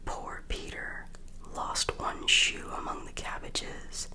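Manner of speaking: ordinary delivery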